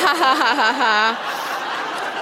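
slow, drawn-out ha ha ha laugh. Real, not acted. Reader laughing at her own old diary. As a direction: laugh it out slowly, each ha separate.

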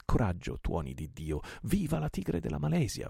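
firm start, then high battle cheer; shouted rallying cry. A narrator's reading. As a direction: urge on, building to a high-pitched cheer. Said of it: "vociò il portoghese". (grido d'incitamento in crescendo)

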